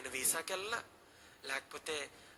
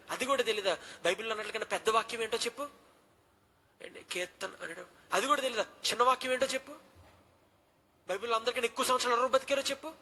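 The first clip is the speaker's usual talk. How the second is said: higher, louder, fast mocking quiz; rapid scolding questions; 6 semitones higher, 5 dB louder than usual. Dean grilling the frightened boy, loud and rapid. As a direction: rattle off the questions to corner him. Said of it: "టకా టకా నన్ను ప్రశ్న వేస్తే".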